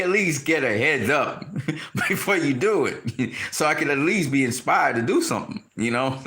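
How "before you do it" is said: usual speaking voice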